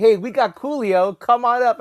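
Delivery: re-enacted upbeat summons; excited invitation. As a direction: share big news and summon a friend. Retold first excited phone call.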